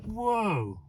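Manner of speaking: high, falling whoa; real surprise; amazed whoa. Real, not acted. Reverse lights amazement.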